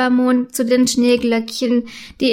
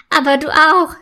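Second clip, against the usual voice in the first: higher, playful hint of a giggle; mildly giggly retort; giggling; 4 semitones higher, 4 dB louder than usual. A narrator's reading. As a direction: tease a friend lightly. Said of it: "fügt sie kichernd hinzu".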